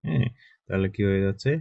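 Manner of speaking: ordinary delivery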